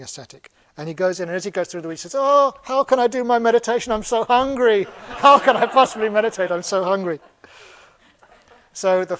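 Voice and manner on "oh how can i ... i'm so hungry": loud, high theatrical moaning; mock lament, loudly projected; hammed-up hungry wail. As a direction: wail it out like a starving old sage. Disguised sage moans of hunger.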